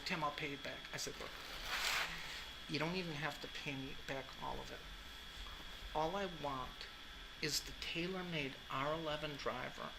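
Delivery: soft, low-key retold talk; quiet retelling. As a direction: keep it hushed and low.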